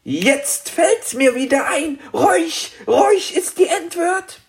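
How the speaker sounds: very high-pitched dragon, sudden realisation; aufgeregter kleiner Drache. Acted. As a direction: cry out the discovery in a tiny high dragon voice. Dragon jumps up with the answer. (ganz hohe, aufgeregte Drachenstimme)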